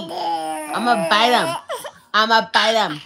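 loud mock menace to a baby; pretend-threaten, loud and punchy; playful threat. Mama threatening to nibble baby's feet, playful mock threat.

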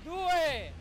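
ordinary talk, nothing marked

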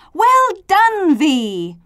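high, loud praise falling at the end; chorused cheer. A narrator's reading. Letters congratulating V, loud cheer. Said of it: "The letters chorused".

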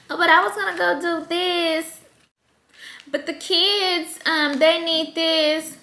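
high, slow whiny excuse-making partner; 아이들 핑계를 대며 높고 느리게 징징대는 남편; partner's excuse. Retold or imitated. Partner making excuses about the kids.

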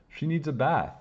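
high-pitched, mildly worried; a touch of worry, voice lifted. A narrator's reading. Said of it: "Hannah said worriedly".

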